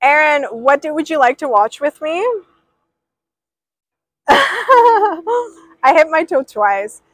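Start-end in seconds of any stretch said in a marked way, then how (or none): 4.3-5.2 sharp high yelp of pain; pained cry. Real, not acted. Streamer stubbing her toe, a real yelp of pain. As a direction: yelp as the toe gets hit.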